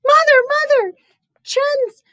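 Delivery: put-on high, loud frantic child's voice; crying out. Brother running to their mother, high panicked cry. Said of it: "Chen's brother cried".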